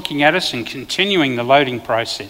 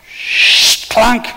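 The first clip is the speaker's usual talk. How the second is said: unvoiced hiss then a loud high clunk; whoosh and clunk; 7 semitones higher, 5 dB louder than usual. Cop retelling the ambush, mimicking the arrow's whoosh and thud. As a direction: mimic the arrow flying and hitting. Imitating the crossbow shot.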